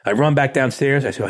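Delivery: ordinary delivery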